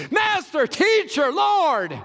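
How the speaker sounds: high, loud overlapping cries; chaotic shouting. Shown as a demonstration. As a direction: shout every name at once in panic. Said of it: "this is pandemonium. This is chaos".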